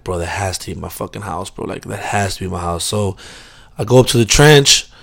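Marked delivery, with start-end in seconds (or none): 0.0-2.8 put-on low, soft drunk mumble; drunk muttering to himself. Drunk guy walking home, muttering to himself, hushed. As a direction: mumble it under your breath, half gone. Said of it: "I'm like".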